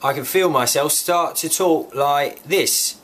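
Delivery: slow, badly imitated English accent; exaggerated English accent, drawn out; thick English accent. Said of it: "they had this very thick, strong accent".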